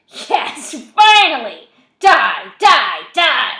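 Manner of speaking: high, very loud triumphant cries; exultant screaming. A narrator's reading. Voices in Zach's head willing him to die, screaming. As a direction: scream each word like a demon cheering.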